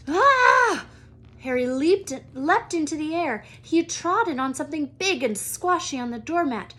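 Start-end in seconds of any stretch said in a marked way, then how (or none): 0.1-0.9 sudden high loud cry; high, loud shocked shriek; startled yelp. Acted. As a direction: yelp as the foot lands on something alive. Stepping on something squashy, startled.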